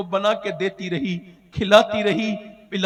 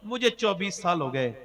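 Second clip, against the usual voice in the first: usual speaking voice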